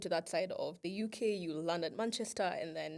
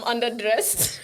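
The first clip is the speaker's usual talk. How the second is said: laughing through her words, higher and louder; 5 semitones higher, 13 dB louder than usual. Real, not acted. Friend laughing at looking like a drug mule, louder and higher. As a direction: giggle while listing what looked suspicious.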